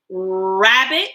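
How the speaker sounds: "Rabbit" with a long, held R at the start; drawn-out first sound. Shown as a demonstration. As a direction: stretch the opening R of "Rabbit". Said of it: "Listen to the beginning sound".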